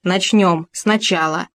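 usual speaking voice

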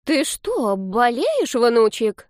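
put-on high, solicitous old-lady voice; caring granny. A narrator's reading. Old woman asks grandson if ill, high caring voice. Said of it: "заботливо спросила пожилая женщина".

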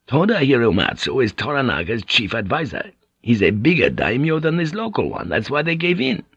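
high, lilting foreign-sailor voice; animated Portuguese pilot. Acted. Pilot explaining the dangers of samurai to Blackthorne, animated. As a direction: explain with colourful, emphatic bravado.

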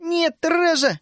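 high-voiced desperate plea; pleading. A narrator's reading. Вишня imploring Тереза not to fire, pleading. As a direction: beg in a high girlish voice. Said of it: "взмолилась Вишня".

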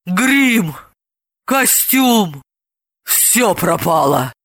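grief-stricken clucking, drawn out at the end; Елизавета Бойцова's lament. A narrator's reading. Santa grieves over ruined make-up and costume, clucking lament. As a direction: lament each loss as a disaster. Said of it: "убиваясь от горя, заквахтал голосом Елизаветы Бойцовой".

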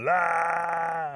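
long high loud "aah"; hollered wail. A narrator's reading. Rabbit keeps hollering from sticker bushes, mock wail. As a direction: sustain a put-on howl. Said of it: "He's still hollering".

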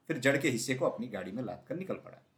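ordinary delivery

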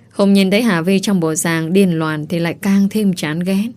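ordinary delivery

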